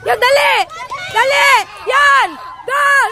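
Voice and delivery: short, eager sideline calls; excited urging. Real, not acted. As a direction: hurry them toward the goal. Urging players toward goal.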